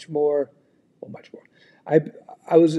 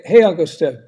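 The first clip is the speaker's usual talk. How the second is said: ordinary talk, nothing marked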